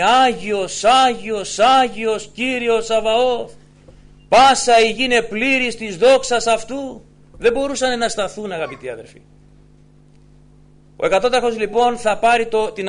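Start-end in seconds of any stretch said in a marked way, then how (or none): 0.0-7.0 Άγιος cried out, louder each time; loud proclamation. Retold or imitated. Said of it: "φωνάζανε με δυνατή φωνή". 7.4-8.5 rising, ringing sermon voice; fervent preaching. Acted. Preaching builds to full force.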